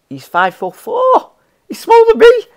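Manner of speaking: high, loud squeal of disbelief; incredulous yell rising into a squeal; astonished outburst. Real, not acted. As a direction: exclaim in real shock, voice shooting way up. Amazed at a player's height.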